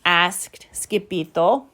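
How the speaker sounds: ordinary delivery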